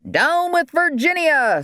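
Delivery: very high, drawn-out rallying cry; strange-accented cry. A narrator's reading. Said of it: "someone with a strange accent cried out".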